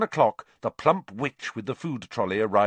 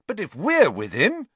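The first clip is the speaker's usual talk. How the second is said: loud, high insistence; plucky, raised-voice protest; spirited; 11 semitones higher, 6 dB louder than usual. A narrator's reading. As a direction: argue back with spirit. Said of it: "said Ron spiritedly".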